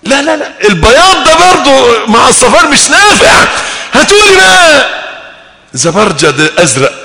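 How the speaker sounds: spoiled man in paradise yelling for blue gems, mock; mock shouting demands. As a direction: yell at the servants like a spoiled king. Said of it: "طب بس ما تزعقش".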